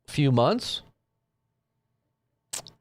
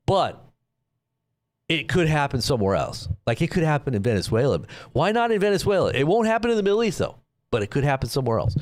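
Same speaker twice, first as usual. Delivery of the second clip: usual speaking voice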